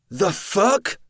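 shrill shocked scream. Acted. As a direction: scream in horror. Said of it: "Cody screamed".

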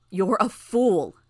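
slow, loud contempt; scornful rebuke. A narrator's reading. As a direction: spit out the insult. Alexandria rebukes Tattletale.

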